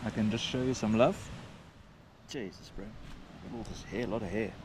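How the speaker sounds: hushed and fond, to a dog; soft talk to a dog. Real, not acted. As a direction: murmur gently to the dog.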